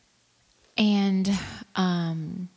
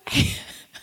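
real laugh. Real, not acted. Recalling the cringeworthy show, bursting into real laughter.

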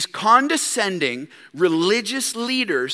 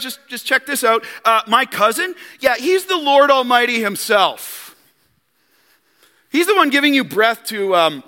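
loud, higher gloating brag; pulling rank; 6 semitones higher, 4 dB louder than usual. Retold or imitated. John flaunting his cousin to the priests, gloating. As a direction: lord it over them with a grin. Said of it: "could have pulled so much rank".

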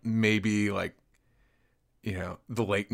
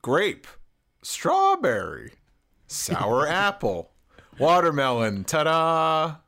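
ad announcer; 5 semitones higher, slower than usual. Shown as a demonstration. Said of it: "[name], walk us through it".

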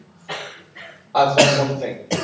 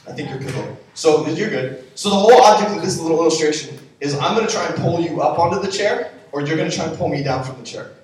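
usual speaking voice

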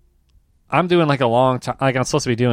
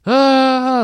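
high startled gasp-word, louder; 12 semitones higher, 5 dB louder than usual. Retold or imitated. Walking into the loud Uber, a high startled ah. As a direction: jolt at the blast of music. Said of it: "I'm like".

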